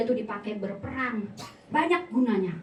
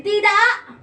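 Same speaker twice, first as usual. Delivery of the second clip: sudden loud, higher burst; emphatic refusal; 9 semitones higher, 8 dB louder than usual. Retold or imitated. Preacher recalls talking herself into self-control, emphatic burst. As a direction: punch it out firmly.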